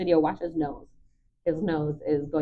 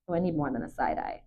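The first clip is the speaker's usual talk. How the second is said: quiet aside to dog; 4 semitones lower, 7 dB quieter than usual. Real, not acted. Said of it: "I go".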